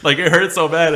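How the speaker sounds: high, loud laughing words; real laughter. Real, not acted. Telling friends about childhood injury, laughing hard. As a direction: talk through a big laugh.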